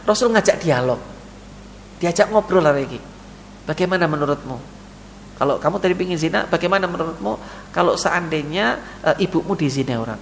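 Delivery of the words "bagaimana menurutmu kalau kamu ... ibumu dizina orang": gentle questioning. Acted. Prophet gently questions youth.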